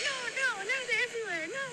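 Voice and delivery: put-on high, upset old lady; mock flustered old woman. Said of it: "and she was like".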